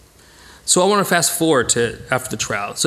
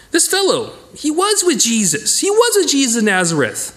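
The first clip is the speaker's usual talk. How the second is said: put-on high, pointed accusing girl's voice; accusing servant girl; 9 semitones higher than usual. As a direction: point the finger at him, pitched up high.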